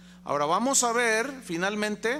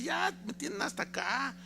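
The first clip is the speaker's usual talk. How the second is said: higher, softer plaintive pleading; sad devil; 7 semitones higher, 4 dB quieter than usual. Retold or imitated. Devil in the joke pleading with the preacher, higher and plaintive. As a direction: devil begging to be heard. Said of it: "le dice".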